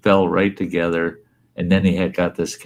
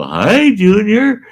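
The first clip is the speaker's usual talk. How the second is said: put-on much higher, louder, playful hello to a kid; sing-song greeting; 8 dB louder, slower than usual. Brother greeting a toddler in a cart, sing-song. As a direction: greet the little kid brightly.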